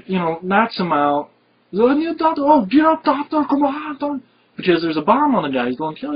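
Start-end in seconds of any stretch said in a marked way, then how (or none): 1.7-4.2 mock panicked plea. Hero fakes panic to trick a guard, high hammed-up yell. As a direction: play a hysterical victim, overdone. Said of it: "Arnold playing like".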